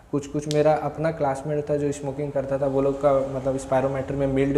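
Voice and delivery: ordinary talk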